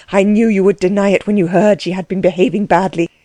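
usual speaking voice

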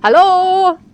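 a loud, high call up to the man on the ladder; loud cry. A narrator's reading. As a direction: shout Hallo up high and loud. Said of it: "cries out very loud".

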